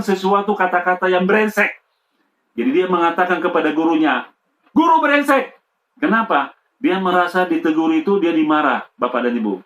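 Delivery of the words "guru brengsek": put-on child's insult.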